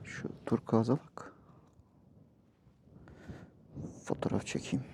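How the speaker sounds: hushed whisper. Real, not acted. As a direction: whisper it softly in awe. Quiet awe at the view. (fısıldayarak)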